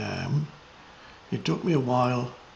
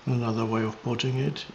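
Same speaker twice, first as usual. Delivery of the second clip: meditative teaching, measured and spacious; contemplative spiritual guidance; 6 dB quieter than usual. Real, not acted. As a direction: guide listeners into presence, unhurried.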